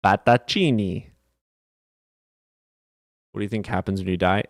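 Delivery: normal talking voice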